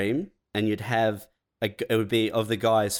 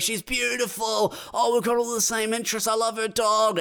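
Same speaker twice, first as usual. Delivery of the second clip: much higher, gushing falsetto voice; swooning lovestruck guy; 12 semitones higher than usual. Acted. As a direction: gush in a high swoon over her profile.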